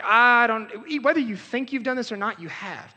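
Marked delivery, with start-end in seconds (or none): 0.0-0.7 defiant rebel. Acted. Mock rebel voicing defiance.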